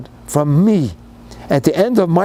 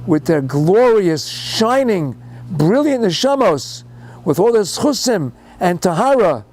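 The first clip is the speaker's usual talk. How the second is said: high, rising, fervent sermon voice; impassioned preaching; 6 semitones higher than usual. Acted. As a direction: preach it with mounting anguish, pitched high. Fiery preaching climax.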